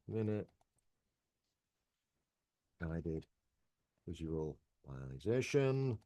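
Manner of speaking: slow, low syllable-by-syllable sounding out; spelling while typing. Real, not acted. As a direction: sound it out slowly as you type.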